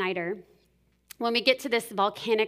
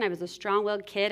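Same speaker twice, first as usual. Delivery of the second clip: normal talking voice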